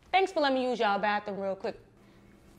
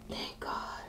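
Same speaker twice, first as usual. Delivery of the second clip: whispered sigh of relief; hushed, private relief; relieved whisper; 12 dB quieter than usual. Acted. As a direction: breathe it out under your breath in relief. Relieved alone in bathroom.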